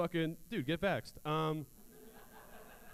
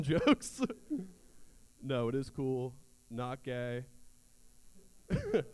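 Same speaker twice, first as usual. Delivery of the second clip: usual speaking voice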